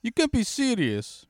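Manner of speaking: high, loud mock outrage in disbelief; exasperated Italian chef. Mocking the Italian chef's outraged protest on the yacht, high and loud. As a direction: squeal it like an indignant Italian chef. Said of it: "The Italian food was like".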